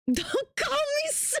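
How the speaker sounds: very high squeak breaking into laughter; hammed-up tiny high voice; mock protest. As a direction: squeal it as the young viewer, about to crack up. Voicing viewer's message, cracking up.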